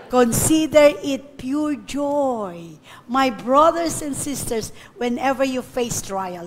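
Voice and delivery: very slow, weighty reading; solemn scripture. Real, not acted. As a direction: draw out each word of the verse. (mabagal na pagbasa ng talata)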